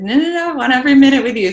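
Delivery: fast, high-pitched mock protest; eager insistence. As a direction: imitate an eager partner rushing to insist. Partner insists on driving her.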